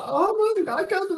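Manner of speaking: high, drawn-out amazed cry; awed crowd member. Acted. Onlooker amazed by the changeling's act, high awed exclamation. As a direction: marvel in a high, stretched voice. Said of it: "applause from the crowd".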